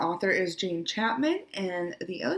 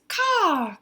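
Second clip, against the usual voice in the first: loud, high imitated crow call; raven's caw; 9 semitones higher, 8 dB louder than usual. A narrator's reading. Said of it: "Raven says".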